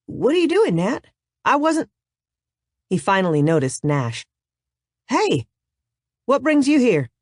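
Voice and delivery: jolted out of a trance; startled painter. Acted. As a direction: artist startled to see his brother. Grayson snapping out of trance.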